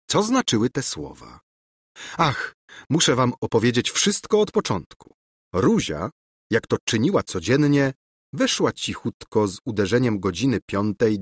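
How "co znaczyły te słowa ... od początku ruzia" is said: plain reading voice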